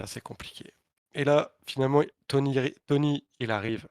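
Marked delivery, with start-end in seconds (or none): 0.0-0.7 whispered, deflated aside. Retold or imitated. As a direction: breathe it out under your breath. Répond à voix basse, dépité. (chuchoté, dépité)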